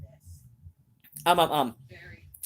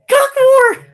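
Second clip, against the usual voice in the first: high, loud shout of a man breathless with laughter; laughing shout; 15 dB louder than usual. Retold or imitated. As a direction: blurt it out at the top of your voice. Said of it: "And I say".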